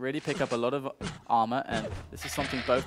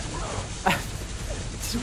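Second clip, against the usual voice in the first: breathy disbelieving laugh; 8 semitones higher than usual. Real, not acted. Caster reacts to play.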